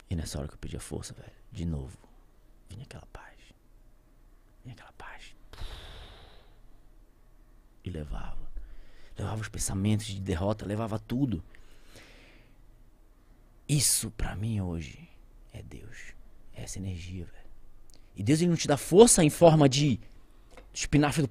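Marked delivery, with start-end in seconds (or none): 2.7-8.5 whispered, reverent; almost no voice, awed; hushed awe. Real, not acted. Awed recollection of inner peace. 13.7-17.5 slow, whispered confession; a hushed, slow testimony; reverent whisper. Real, not acted.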